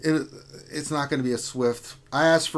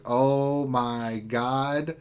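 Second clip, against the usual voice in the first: very slow, drawn-out exasperation; mom eye; much slower than usual. Retold or imitated. Imitating a mom with raised eyebrow, slow and drawn out. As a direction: stretch each word out like an unimpressed mom. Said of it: "that mom eye, where it's like".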